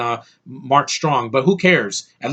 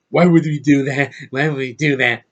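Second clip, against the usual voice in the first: cartoonish mock movie-hero voice; Tom Hardy. Reviewer parodying the lead questioning his monster, cartoonish impression. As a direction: answer the monster like a goofy cartoon character. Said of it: "you sound like a freaking cartoon".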